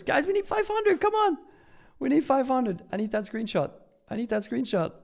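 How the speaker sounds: hyped streamer begging the crowd; excited plea. Real, not acted. Begging viewers to reach five hundred.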